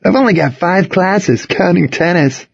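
high-pitched casual student voice; lightly voiced teenager. A narrator's reading. Jimmy explains his senior schedule, lightly voiced teenager. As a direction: say "counting tennis" as a plain high-pitched add-on.

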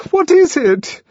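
high, loud frightened cry; almost scream the question; near scream. A narrator's reading. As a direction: panicky high-pitched demand. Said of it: "she almost screamed".

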